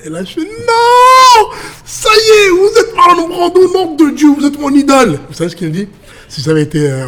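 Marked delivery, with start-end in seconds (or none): 0.4-5.2 very high, loud fan screaming; starstruck outburst. Retold or imitated. Fan to Marlon Brando on learning who he is, starstruck shout. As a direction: cry out in overwhelmed disbelief at an idol.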